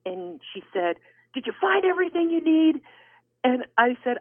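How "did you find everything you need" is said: high, tight customer-service question; mad checker. Retold or imitated. Mad cashier to the next customer, irritated. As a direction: ask the stock question while clearly mad, pitched up. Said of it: "and she was mad".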